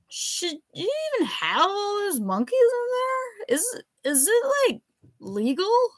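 put-on a drunk wondering aloud about the law; drunk professor. A narrator's reading. Drunk professor voiced in story.

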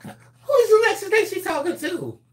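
very high, shocked outrage; indignant disbelief. Retold or imitated. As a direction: squeal it in offended disbelief. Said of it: "We were like".

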